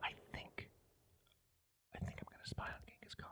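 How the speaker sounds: hushed unvoiced whisper; sneaky whisper to himself while plotting. Real, not acted. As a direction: whisper it like a secret plan. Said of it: "Why am I whispering?".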